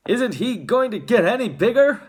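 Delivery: high, loud shout. A narrator's reading. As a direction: shout it in exasperation. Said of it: "shouted Mister Teavee".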